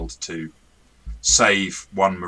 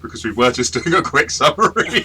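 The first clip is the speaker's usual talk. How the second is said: laughing through the line, higher and louder; 9 semitones higher, 11 dB louder than usual. Real, not acted. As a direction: crack up mid-sentence at your own joke. Laughing at own pun on bogging down.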